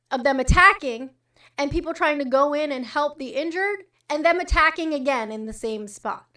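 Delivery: ordinary talk, nothing marked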